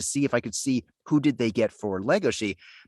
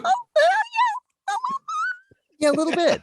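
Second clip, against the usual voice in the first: breaks into high laughter on the yeah; laughing it out, voice cracking up high; 3 dB louder than usual. Real, not acted. Laughing at the host's tail-swinging joke.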